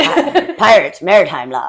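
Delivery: put-on pirate accent, a laugh in it; hammed-up Irish pirate. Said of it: "It sounded like an Irish pirate".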